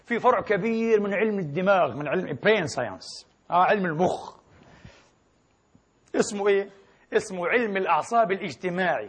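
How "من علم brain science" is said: usual speaking voice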